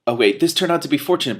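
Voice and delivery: ordinary delivery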